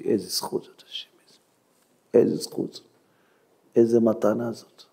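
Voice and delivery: soft, low, awed murmur; hushed gratitude. Acted. Humiliated man thanking God for the privilege, hushed. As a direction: quietly marvel at a gift.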